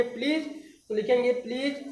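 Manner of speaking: ordinary talk, nothing marked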